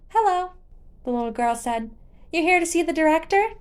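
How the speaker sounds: put-on little girl greeting the visitor. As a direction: chirp it like a small girl. Little girl voiced in story.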